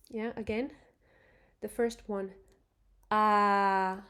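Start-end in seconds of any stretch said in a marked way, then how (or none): 3.1-4.0 "ah" held level at mid pitch, like a robot; a flat, steady "ah" in the middle of the voice. Shown as a demonstration. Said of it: "that's middle".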